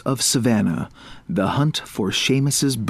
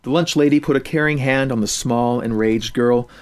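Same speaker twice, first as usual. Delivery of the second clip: ordinary delivery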